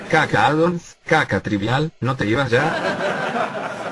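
slow, laughing mockery; mocking laugh. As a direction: drag it out while laughing. Se burla, riendo. (burlón entre risas)

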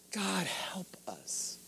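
slow, barely voiced whisper; whispered plea. Pastor asking God's help, slow hushed whisper. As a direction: whisper it as a helpless little prayer. Mock-weary prayer after imagined complaint.

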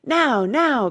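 high, loud urging; urgent cry. A narrator's reading. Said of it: "cried the Queen".

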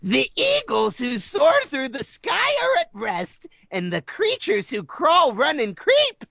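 low, measured bedtime reading with deadpan swearing; deep storybook narration. Acted. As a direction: read it like a solemn bedtime story, sinking low. Reads the parody bedtime book.

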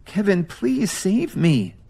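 high, slow, tearful plea. Acted. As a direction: beg through tears. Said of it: "Lily said tearfully".

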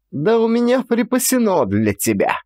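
high shrieking cry; shrill shout. A narrator's reading. Ulyana shrieking at her drunk son, high shriek. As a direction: screech it out at him. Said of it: "кричала визгливо Ульяна".

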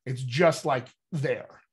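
usual speaking voice

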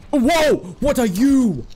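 loud, high startled whoa; real alarmed surprise; startled shout. Real, not acted. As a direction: blurt it out as something jumps at you. Strange creature suddenly appears.

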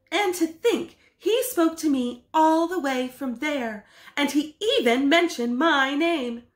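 high, eager wonder; thrilled. Acted. Husband thrilled the admiral named him, excited. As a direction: glow with pride.

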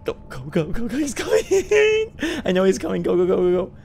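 high, loud frantic urging; breathless fright. Real, not acted. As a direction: panic while being chased. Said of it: "being chased".

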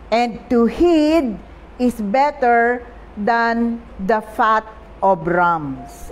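very slow, solemn close of the verse; scripture reading. Acted. Preacher finishing the Bible verse to the congregation, very slow. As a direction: draw out the last words of the verse.

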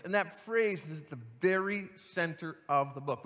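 ordinary talk

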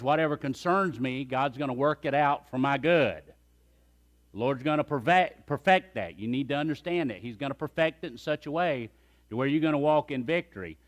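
ordinary delivery

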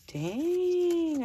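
high, loud mock amazement; playful outburst of admiration; impressed exclamation. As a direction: belt it out, wowed. Acted amazed reaction to friend's skill.

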